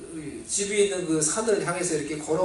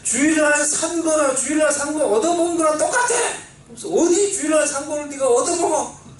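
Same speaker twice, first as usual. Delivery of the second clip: higher, louder scolding rebuke; angry father; 8 semitones higher, 4 dB louder than usual. Retold or imitated. Father scolding his son for eating a Sunday snack, higher loud rebuke. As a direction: tell him off like a strict father. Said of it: "갑자기 아버지가".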